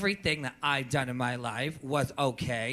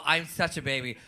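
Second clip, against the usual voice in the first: ordinary talk, nothing marked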